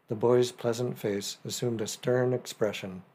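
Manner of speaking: ordinary delivery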